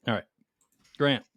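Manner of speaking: usual speaking voice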